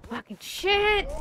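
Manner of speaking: loud high curse; angry outburst. Real, not acted. Streamer startled by something, shouting a curse. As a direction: yell it out in sudden frustration.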